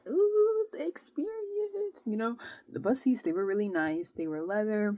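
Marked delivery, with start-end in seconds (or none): 0.1-2.0 high, slow drawn-out ooh of wonder; mock awe. Admiring the fancy bus, high slow mock awe. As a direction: savour it like a tourist. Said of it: "I'm like".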